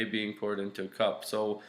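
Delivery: ordinary talk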